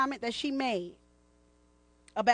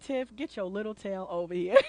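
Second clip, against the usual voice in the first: a hint of a low teasing husband; husband calling her over, lightly voiced; 6 semitones lower than usual. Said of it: "my husband would've said".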